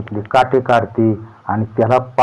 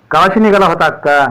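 higher, louder friendly greeting; welcoming question; 8 semitones higher, 6 dB louder than usual. A narrator's reading. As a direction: greet the arriving sister-in-law loudly. Wife greets arriving sister-in-law. (नणंदेला स्वागत करत विचारणारी वहिनी, उंच आवाजात)

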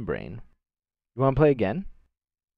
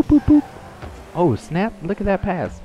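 ordinary talk

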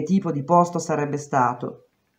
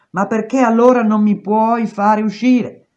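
higher and louder demand; exasperated; 5 semitones higher, 5 dB louder than usual. A narrator's reading. Prisoner demanding release from the guard, exasperated. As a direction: push back hard at the guard.

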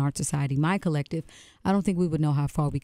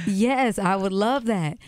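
laughing; 5 semitones higher, 3 dB louder, much slower than usual. Real, not acted. Overjoyed accepting Prince's invitation.

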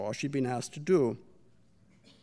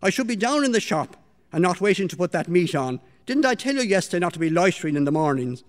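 berating; 3 semitones higher, 6 dB louder, faster than usual. Acted. Mistress berates late maid.

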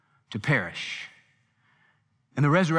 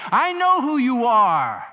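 slow, louder cry, higher; demon crying out; 6 semitones higher, 5 dB louder, slower than usual. Retold or imitated. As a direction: cry it out like a possessed man. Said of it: "they'd cry out".

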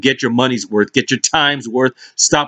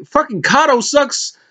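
scornful jab; 13 semitones higher than usual. Retold or imitated. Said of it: "I was like".

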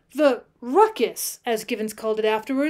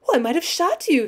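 higher, startled realization; exclaiming; 5 semitones higher, 4 dB louder than usual. A narrator's reading. Wide-eyed girl realizes the danger, exclaiming. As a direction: exclaim it with raised pitch. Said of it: "she exclaimed".

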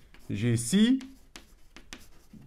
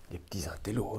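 a hushed, breathy little aside to the class; mock whisper; 4 semitones lower, 7 dB quieter, faster than usual. Teacher whispers a teasing aside to pupils, in a mock whisper. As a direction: lean in and whisper it like a joke.

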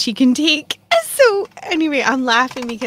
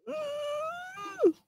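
mock whimper; 9 dB quieter than usual. Miserable in the heat.

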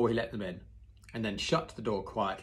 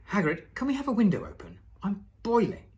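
hot complaint; 11 semitones higher, 7 dB louder than usual. Acted. Harry complains of heat.

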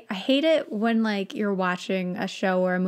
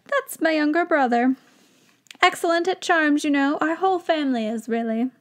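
higher, proud little wizard voice; weird wizard accent; 6 semitones higher than usual. Acted. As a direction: boast about the family in a funny accent. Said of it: "I gave him this weird accent".

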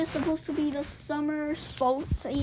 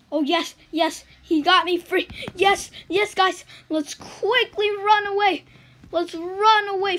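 louder, higher joyful yeses; excited; 5 semitones higher, 6 dB louder than usual. Real, not acted. Gamer freed from jail telling viewers, excited cheer. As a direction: cheer the escape loudly.

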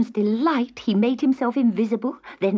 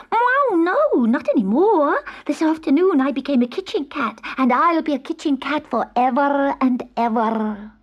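protesting kitten; 7 semitones higher than usual. Acted. Kitten denying he is a witch's cat, high and indignant. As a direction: protest earnestly as a little cat.

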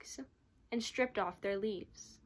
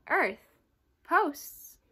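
higher, louder puzzled questions; boy puzzled by the chief's strange requests, higher and louder; puzzled echo; 7 semitones higher, 8 dB louder than usual. A narrator's reading. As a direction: repeat the words in disbelief.

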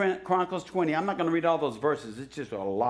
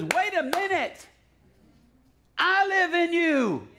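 higher, slow, ringing shouts of God's command; fiery preacher proclaiming to the congregation; preaching at full force; 11 semitones higher, slower than usual. Acted. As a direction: thunder it from the pulpit, calling the church awake.

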